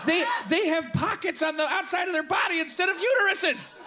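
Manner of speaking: high-pitched, worked-up comic outrage; mock horrified rant. Comic ranting about kangaroo anatomy, high mock-horrified outburst. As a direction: rant as if appalled by these animals.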